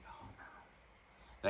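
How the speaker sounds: whispered oh no under the breath; breathy dismay. Real, not acted. Told the store people know the attacker, a whispered dismay. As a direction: let it slip out as a voiceless whisper.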